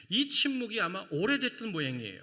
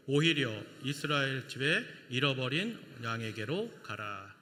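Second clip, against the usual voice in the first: slow, lower, even reading of the verse; slow scripture reading; 4 semitones lower than usual. Shown as a demonstration. Pastor reads Matthew aloud with the church, slow and solemn. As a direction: let each word of scripture settle. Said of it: "다 함께 읽겠습니다".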